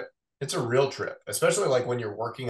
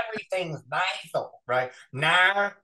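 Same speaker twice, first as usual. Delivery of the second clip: sloppy Australian impression, pitched up; bad Australian accent; 6 semitones higher than usual. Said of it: "like a really bad Paul Hogan commercial".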